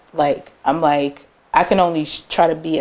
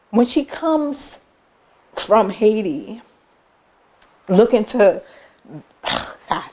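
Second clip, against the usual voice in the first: real emotion, higher and halting; tearing up; 4 semitones higher, slower than usual. Real, not acted. Daughter telling of mom coming from Haiti, tearing up. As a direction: fight back tears between phrases, sighing. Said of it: "I am tearing up".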